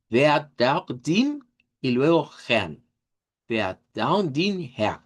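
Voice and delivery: "Wer da dim Herr" said slowly, with a long i in "dim"; German phrase. Shown as a demonstration. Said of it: "pensemos en la I del inglés". (frase alemana lenta y clara)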